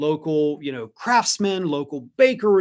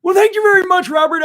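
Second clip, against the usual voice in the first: shouted, much higher pillow-salesman pitch; Mike Lindell impression; 9 dB louder, faster than usual. Thanking a viewer for the compliment, in a loud, high pitchman voice. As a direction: belt it out like a hyped-up pitchman. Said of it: "Your Lindell impression is awesome".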